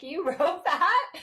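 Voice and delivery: high, loud, slow and laughing; a kid who can't believe Mom wrote it; incredulous giggle; amazed laughing disbelief. Retold or imitated. Kids amazed mom wrote book.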